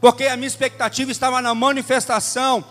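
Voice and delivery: loud, emphatic sermon testimony; forceful preaching. Real, not acted. Pastor retelling his resolve before God, forceful preaching. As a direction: declare it with building conviction, then ease off.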